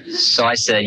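usual speaking voice

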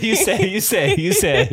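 high, stretched words through giggles; laughing. Real, not acted. Husband struggling to answer his wife, laughing.

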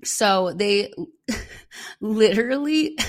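helpless laughter breaking up the words, voice lifting; can't stop laughing. Real, not acted. Host laughing at her own contradicting notes, real helpless giggles. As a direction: start the sentence and crack up laughing. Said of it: "I can't stop laughing".

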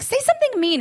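mock pleading, pitched high. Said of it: "I'm like".

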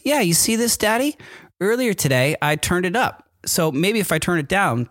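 put-on high, proud little-kid voice; child voice. Dad imitating his son pointing at the valve, high child voice. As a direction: show it off like an eager boy.

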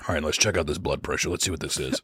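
low, soft grandpa voice. Retold or imitated. Playing grandpa at the machine.

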